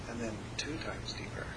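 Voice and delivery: whispered aside. Real, not acted. Teacher whispering the student's question, unvoiced. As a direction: breathe the words without voice.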